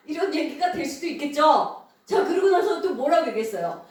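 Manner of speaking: normal talking voice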